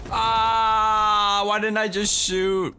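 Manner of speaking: high pained shout; frustrated yell. Real, not acted. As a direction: wail at your own mistake. Gamer missing a shot.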